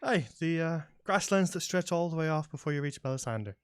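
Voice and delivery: lightly voiced country local; a hint of a folksy farmer, explaining; friendly elven farmer. Elven farmer tells travellers about Sunnyside, folksy and friendly.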